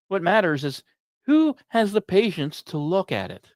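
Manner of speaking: ordinary talk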